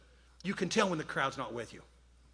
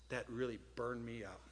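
ordinary talk, nothing marked

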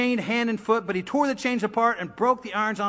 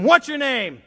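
raised, loud question; commanding demand; 5 semitones higher, 7 dB louder than usual. Acted. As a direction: demand an answer from a demon. Jesus confronting the demon.

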